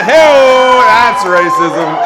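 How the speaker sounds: big drawn-out holler; mock shout. Comic ribs the crowd for racism, shouted and stretched. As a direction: stretch it out loud like a heckler.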